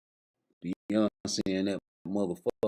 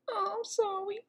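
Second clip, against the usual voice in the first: much higher, soft put-on voice; high mock apology; 5 dB quieter than usual. Woman apologizing in a high squeaky voice.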